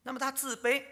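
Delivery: usual speaking voice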